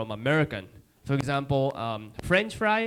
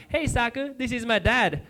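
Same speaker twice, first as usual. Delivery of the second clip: higher, cheerful introduction; girlfriend voice; 8 semitones higher than usual. Retold or imitated. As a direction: introduce him brightly. Girlfriend introduces her dad.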